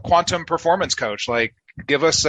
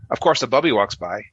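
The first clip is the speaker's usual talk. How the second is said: ordinary talk, nothing marked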